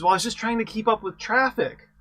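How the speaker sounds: high, loud, innocent driver's excuse; a bright, pleading punchline excuse; excuse to the cop. Acted. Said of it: "your response".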